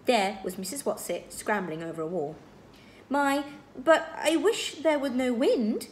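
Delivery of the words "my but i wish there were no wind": slow, higher, louder lament; plaintive. A narrator's reading. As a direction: complain plaintively, drawing out the words. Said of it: "Missus Watsit said plaintively".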